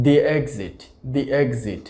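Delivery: "the exit" as "di exit"; long ee on "the", then exit. Shown as a demonstration. Teacher models di exit for the class. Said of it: "উচ্চারণ করছি দি".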